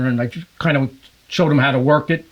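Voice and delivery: ordinary delivery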